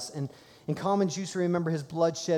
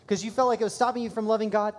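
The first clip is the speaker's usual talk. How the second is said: quoting his wife; 6 semitones higher, 3 dB louder than usual. Retold or imitated. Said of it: "she goes".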